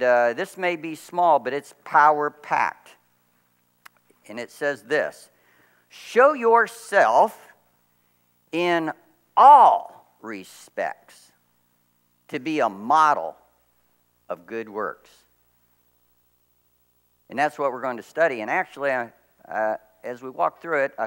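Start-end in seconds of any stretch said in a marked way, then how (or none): 5.9-15.1 drawn-out words, a breathy hush on all respects; slow emphatic scripture reading. Acted. Pastor reads the verse slowly to his congregation. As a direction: read each phrase slowly, weighing it.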